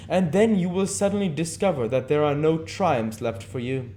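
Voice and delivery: lifted, seductive aristocratic lecture; Lord Henry voice. Acted. Lord Henry urging Dorian to live his youth, lifted lord voice. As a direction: warn him grandly of fading youth.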